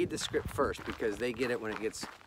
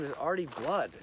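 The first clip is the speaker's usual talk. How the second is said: ordinary delivery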